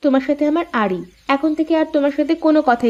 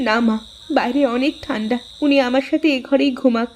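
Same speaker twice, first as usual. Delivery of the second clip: normal talking voice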